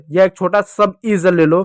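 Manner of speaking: normal talking voice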